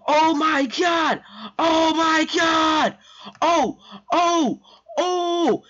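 drawn-out, very high shrieks of shock; over-the-top stunned shrieking; cracking high scream. Retold or imitated. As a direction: scream each word long and high until the voice cracks. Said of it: "my voice started cracking when I hit those high notes".

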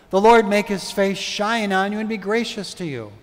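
ordinary talk, nothing marked

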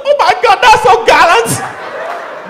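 high loud swoon; gushing wife. Retold or imitated. Wife gushing over plucked flower, high loud swoon.

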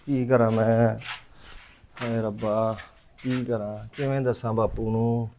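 ordinary delivery

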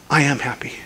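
soft whispered confession; retold whisper. Girl in the facility quietly telling Clint, whispered. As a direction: hush it like a secret joy.

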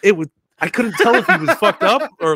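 words broken up by real laughter; laughing while talking. Real, not acted. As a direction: talk through the giggles.

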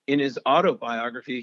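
ordinary delivery, nothing marked

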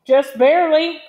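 high, loud reply; calling back. A narrator's reading. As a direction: holler the answer across a distance. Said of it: "Zimmer called back".

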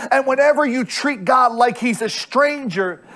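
ordinary talk

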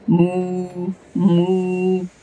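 low, lazy moo like a cow. A narrator's reading. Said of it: "goes the cow".

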